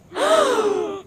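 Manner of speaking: high, loud shocked gasp; sharp gasp. Acted. Player reacting to Joy being accused, shocked gasp.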